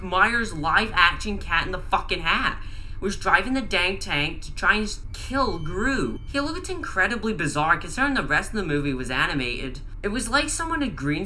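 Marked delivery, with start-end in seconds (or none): none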